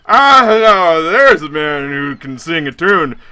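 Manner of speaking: put-on the drunk fiancé greeting a stranger in line, loud and slurred; drunk party guy. Drunk Chad booming at the stranger in line. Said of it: "he perks up and says".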